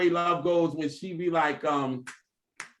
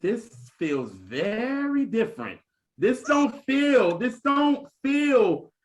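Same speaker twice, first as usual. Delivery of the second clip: slow wondering voice imitating mother; mother savouring the new album, slow and awed; slow, drawn out; slower than usual. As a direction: stretch it out, marvelling. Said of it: "my mother was like".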